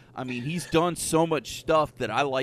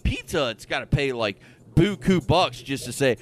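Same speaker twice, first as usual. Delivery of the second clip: normal talking voice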